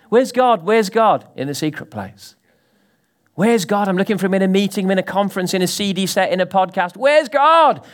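preaching call. Acted. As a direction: hammer the question home.

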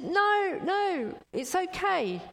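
high, loud shout over the rotor noise; shouting back. Retold or imitated. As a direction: yell your refusal up to the helicopter. Man refuses helicopter rescue shouting.

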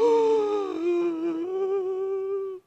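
long sharp intake of breath; high shocked gasp. Retold or imitated. Woman hearing her father's name, a high gasp. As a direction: gasp as if the wind is knocked out.

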